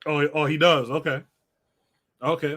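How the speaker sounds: usual speaking voice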